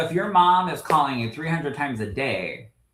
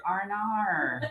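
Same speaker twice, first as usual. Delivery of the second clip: high-pitched Australian "R and R" for "oh no"; Australian accent; 8 semitones higher, 6 dB quieter, slower than usual. Shown as a demonstration. Retelling the TikTok lesson, "R and R" in Australian accent. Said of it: "All you say is R and R".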